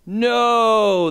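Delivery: put-on long loud kids' "no"; children answering in chorus. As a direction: call it out like a class of kids. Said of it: "the children all answered".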